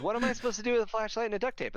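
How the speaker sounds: very high, rapid mock-baffled question; mock incredulous. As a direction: pitch it way up, fast and exasperated. Baffled guardsman in a joke.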